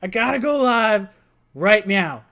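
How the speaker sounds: hammed-up panic to get on air; retelling his own rush home to go live, high and loud; frantic excitement. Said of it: "and I'm like".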